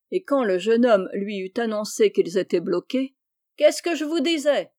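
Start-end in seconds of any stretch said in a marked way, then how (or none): 3.6-4.7 high, loud gloating call; triumphant cry. A narrator's reading. Chabre gloats from the cliff, high triumphant cry. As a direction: crow over being right. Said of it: "cria-t-il triomphalement".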